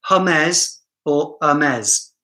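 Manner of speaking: "Hermes" first with H, then without. Shown as a demonstration. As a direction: slowly contrast huh-MEZ and er-MEZ in "Hermes or Hermes". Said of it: "whether you pronounce the H or not".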